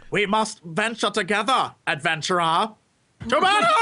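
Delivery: badly acted rallying speech, building to a cry; Traherne. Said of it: "obviously tell he's reading the script".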